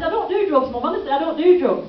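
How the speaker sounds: loud, high, earnest denial; protesting son. Retold or imitated. Son mishearing joint account, loudly and earnestly protesting. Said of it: "he said".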